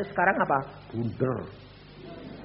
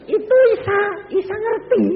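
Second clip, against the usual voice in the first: much higher, loud exclamation; excited; 11 dB louder than usual. Real, not acted. Preacher to the congregation, high and loud. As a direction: call out with delight.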